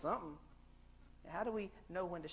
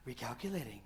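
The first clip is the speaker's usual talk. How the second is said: lower, calm robotic GPS voice; mock GPS voice; 6 semitones lower than usual. Imitating car GPS after missed turn, calm robot voice. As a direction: say it like a friendly sat-nav.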